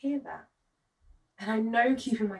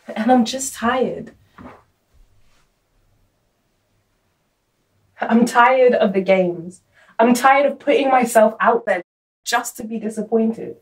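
fed-up outburst; 12 dB louder than usual. Acted. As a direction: let the frustration out loud, dragging each phrase. Fed-up outburst about being ghosted.

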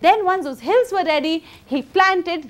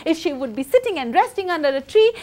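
ordinary delivery

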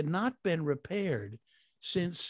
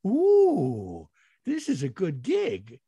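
delighted ooh; 3 semitones higher, 8 dB louder, much slower than usual. Retold or imitated. Delighted at the pay.

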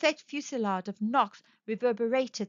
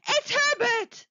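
high, loud frantic cry; scream; 14 semitones higher, 5 dB louder, slower than usual. A narrator's reading. Mother crying out that her son is back, screaming. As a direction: scream the name out, pitched right up. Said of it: "she screamed".

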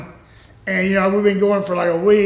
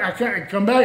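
ordinary delivery

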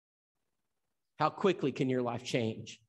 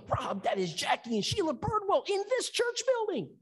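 astonished church lady. Retold or imitated. Deacon's wife voiced, astonished.

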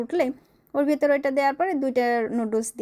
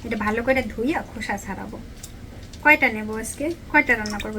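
ordinary talk, nothing marked

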